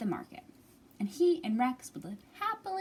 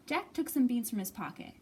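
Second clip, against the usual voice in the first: ordinary delivery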